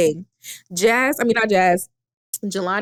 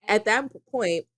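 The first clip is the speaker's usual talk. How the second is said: ordinary delivery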